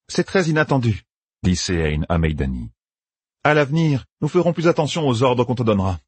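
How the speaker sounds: a cold put-down of Meidani; cool Aes Sedai. Acted. Sehain to Meidani, lightly voiced and cool.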